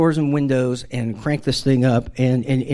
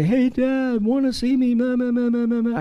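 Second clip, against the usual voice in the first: put-on high-pitched child calling Dad; little kid voice; 10 semitones higher than usual. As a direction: pipe up like a small kid, trailing into babble. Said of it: "one of the kids upstairs".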